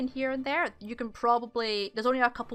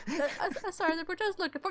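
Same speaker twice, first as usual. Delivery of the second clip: higher, softer stammered apology; flustered guard; 7 semitones higher, 4 dB quieter, faster than usual. Acted. Guard told off by old driver, meek stammering apology. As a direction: stammer meekly as a cowed guard. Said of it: "the guy just kinda still going there like".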